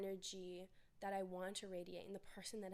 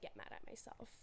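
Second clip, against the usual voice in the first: ordinary talk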